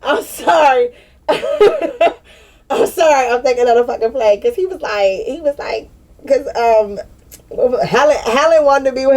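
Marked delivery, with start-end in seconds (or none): 0.0-3.3 high, slow apology lost in helpless laughing; real laughter. Real, not acted. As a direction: gasp out sorry through tears of laughter. Cracking up at the memory.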